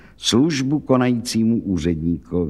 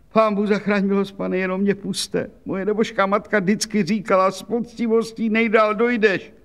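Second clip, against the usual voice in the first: put-on much higher, plaintive old woman pleading her innocence; a frightened old laundress begging; anxious washerwoman; 8 semitones higher than usual.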